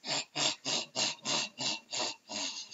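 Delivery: low, breathy, creepy chuckle; a soft, sinister rasping laugh; possessed friend laughing low and rasping; deep raspy laugh. Acted. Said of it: "I heard a deep, raspy laugh".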